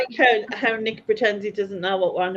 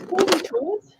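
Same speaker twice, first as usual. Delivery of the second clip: Cozy toes in real Geordie, higher; Geordie accent; 10 semitones higher than usual. Shown as a demonstration. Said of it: "in your Geordie accent".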